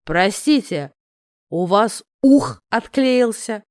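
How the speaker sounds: put-on slow, slurred drunk voice; drunk man. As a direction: drawl it out tipsy and slow. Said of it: "Пьяно мукнул красавец".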